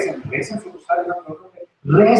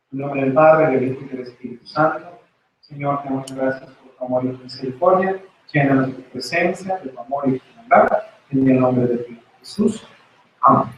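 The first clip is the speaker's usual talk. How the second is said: hushed sermon prayer; closing prayer; 4 semitones lower than usual. Acted. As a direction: lead the congregation in prayer.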